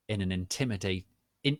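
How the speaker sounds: ordinary delivery, nothing marked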